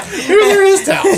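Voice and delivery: high squeal through laughter; laughing. Real, not acted. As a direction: laugh out the question. Laughing at towel joke.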